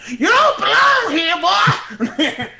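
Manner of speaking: high, loud, slow settler drawl; old settler voice. Retold or imitated. Telling someone they don't belong, in an old settler voice. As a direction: bark it like a hostile old frontiersman. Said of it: "go into your old settler voice".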